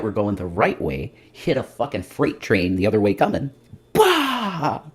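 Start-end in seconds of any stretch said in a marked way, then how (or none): 3.9-4.8 crash noise. Pretend. Freight train hitting life.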